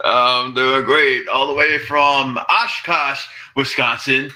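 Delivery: slow, careful stress on "Oshkosh"; emphasis on Oshkosh. Shown as a demonstration. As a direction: lean slowly into "Oshkosh". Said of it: "I gotta put the emphasis on Oshkosh".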